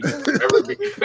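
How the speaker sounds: laughing through the words. Real, not acted. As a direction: say it while cracking up. Laughing repeat of a phrase.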